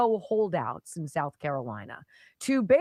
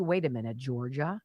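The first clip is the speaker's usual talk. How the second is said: normal talking voice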